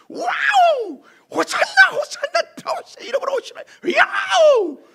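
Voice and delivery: high-pitched, full-force crowd shout; imitated crowd roar. Preacher imitating the crowd welcoming Jesus into Jerusalem, shouting wildly. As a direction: scream it out like thousands cheering at once. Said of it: "그 소리를 흉내를".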